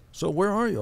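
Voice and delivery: high mock woman's voice; imitating Suzanne. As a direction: pitch it up as Suzanne asks. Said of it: "And she says".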